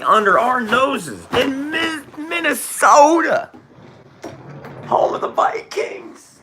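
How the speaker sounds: high, loud impassioned preacher shouting; full-force sermon, voice climbing to shouts; fiery preaching. Acted. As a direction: rail at the congregation, pleading for passion. Impassioned preaching against evil.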